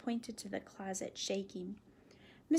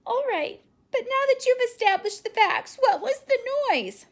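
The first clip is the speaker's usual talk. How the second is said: put-on high, loud child's voice pressing for an answer; insistent little girl; 13 semitones higher, 12 dB louder than usual. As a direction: demand the answer from Daddy. Phoebe presses her father.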